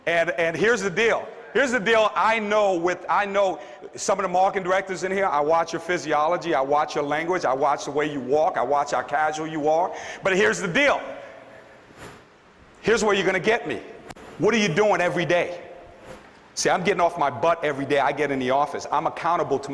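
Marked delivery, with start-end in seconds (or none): none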